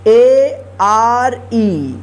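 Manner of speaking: letters spelled one by one, very slow; spelling out letters. Shown as a demonstration. Teacher spelling a word aloud slowly. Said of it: "तो इस ए का ए उच्चारण होता है".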